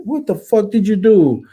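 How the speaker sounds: high, loud, incredulous what the fuck; mock-bellowing at a screwup teammate; retold exasperated yell. Team leader yelling at his loudmouth soldier, exasperated.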